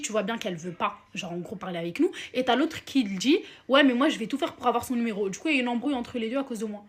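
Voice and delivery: usual speaking voice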